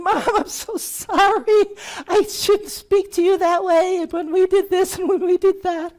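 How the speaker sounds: high, loud put-on voice pouring out an apology; exaggerated tearful-sounding sorry to mom; hammed-up high apology to his mother; high pleading apology. Retold apology call to mother.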